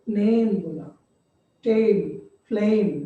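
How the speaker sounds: "नेम", "टेम", "फ्लेम" each with a long ay; long vowel demo. Shown as a demonstration. Teacher showing the effect of final e, "टेम" and "फ्लेम" with a long ay. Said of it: "ए का उच्चारण दीर्घ हो रहा है".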